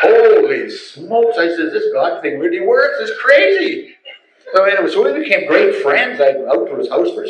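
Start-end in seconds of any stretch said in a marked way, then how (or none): 0.0-3.9 higher, slow amazement breaking into a laugh; amazed delight. Retold or imitated. Telling the friend about God, laughing amazement.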